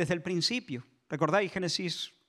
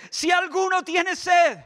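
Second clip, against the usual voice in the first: slow, loud, higher call; Jesus calling to the thirsty at the festival; Jesus crying out; 10 semitones higher, 7 dB louder, slower than usual. Acted. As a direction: shout the invitation across the crowd. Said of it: "Y Jesús dice".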